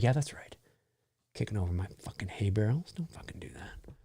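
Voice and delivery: low grumble fading to a whisper; muttered satisfaction. Retold or imitated. Homeowner after kids flee, muttered mock menace. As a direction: mutter it under your breath, retold.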